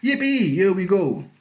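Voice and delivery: high, loud cry of delight; gleeful old man riding up; excited whoop. A narrator's reading. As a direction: whoop with glee, then call out. Grandpa Joe whoops in rising lift.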